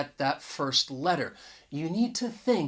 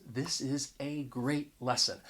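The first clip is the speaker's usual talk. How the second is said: softer, "a" as AY before "great"; emphatic article; 3 semitones lower, 9 dB quieter than usual. Shown as a demonstration. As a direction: underline "a great lesson" with "a" said "ay". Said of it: "I was just trying to emphasize".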